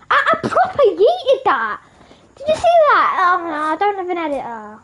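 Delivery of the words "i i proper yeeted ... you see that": high, loud crowing; excited boasting. Real, not acted. Gamer showing viewers the throw, excited yelling. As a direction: crow it loudly, thrilled.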